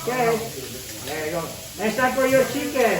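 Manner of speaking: ordinary talk, nothing marked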